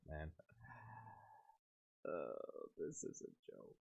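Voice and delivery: breathy whisper, almost no voice; whispered this is a joke; disbelieving whisper. Real, not acted.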